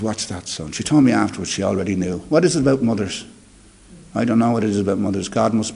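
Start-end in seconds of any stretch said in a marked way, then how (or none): 0.0-0.7 soft, low and gentle; a mother's quiet, knowing reply; tender. Retold or imitated. Mother answering her son on the stairs, soft and gentle.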